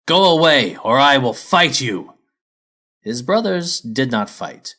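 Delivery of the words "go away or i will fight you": loud, slow dragon bellow; Lazy bellows at his brother dragons to drive them away. A narrator's reading. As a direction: roar it up at the sky to scare them off. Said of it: "and bellowed at his brothers".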